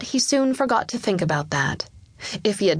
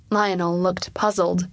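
ordinary delivery, nothing marked

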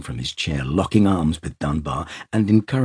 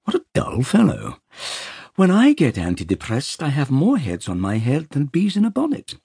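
whimsical eccentric; 10 semitones higher, 3 dB louder than usual. Acted. Peter's whimsical character voice.

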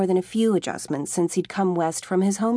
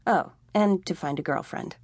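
ordinary delivery, nothing marked

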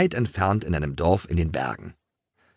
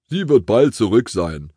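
lightly voiced grandfather, louder; a hint of a grandfather speaking up; grandfather's voice; 3 semitones higher, 5 dB louder than usual. A narrator's reading. Grandfather predicts the mouse's quick return, lightly voiced louder.

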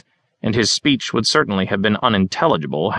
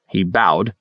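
plain reading voice

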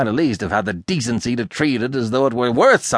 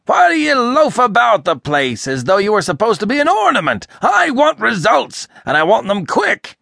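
high, loud, indignant harangue; furious tirade; 8 semitones higher, 5 dB louder than usual. A narrator's reading. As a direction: rant in a sudden burst of fury. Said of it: "burst of fury".

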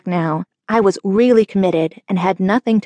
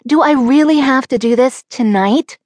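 whiny; 6 semitones higher, 5 dB louder than usual. A narrator's reading. Teen pleading with dad.

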